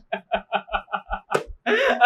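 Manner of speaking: chuckling run of laughter; amused laugh. Acted. Man dodging her anxious question, laughing.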